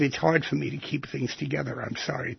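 soft, subdued apology, only a hint of tears; retold tearful apology. Shopper with multiple sclerosis explains to the line, mildly tearful. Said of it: "tears in her eyes".

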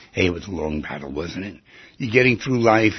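ordinary talk

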